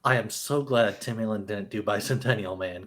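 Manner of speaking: usual speaking voice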